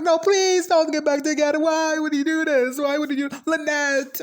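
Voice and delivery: high, teary begging; mock tearful pleading. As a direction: plead with the couple through fake tears. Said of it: "I was like".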